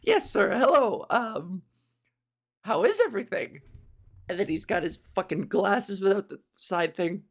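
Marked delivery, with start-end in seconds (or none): 0.0-3.6 hesitant, lightly voiced waiter; flustered nervous waiter. Waiter comes over to Morpheus's table, flustered and nervous. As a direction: greet the diner with a nervous stumble. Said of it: "he's all flustered and nervous".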